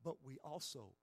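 ordinary talk

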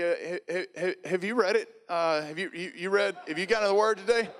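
put-on high, stammering awkward kid voice; nervous freshman. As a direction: stumble through it like a shy kid forced to call. Nervous freshman Rex calling.

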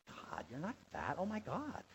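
quiet, lightly mocking teen chatter; imitated teen filler talk. Teenager reassuring a friend with God as filler, lightly mocked. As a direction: softly mimic a teenager, swooping up at the end. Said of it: "using God as like a filler".

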